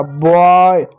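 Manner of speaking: बॉय said slowly and clearly, starting with a crisp ब sound; sounded-out demo word. Shown as a demonstration. Teacher sounding out बॉय for the kids, clear and deliberate. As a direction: spell out बॉय by its sounds, ब then the vowel. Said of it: "इस बी को आपने बी नहीं पढ़ा बल्कि ब पढ़ा".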